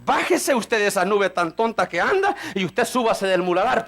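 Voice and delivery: ordinary talk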